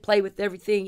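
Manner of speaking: usual speaking voice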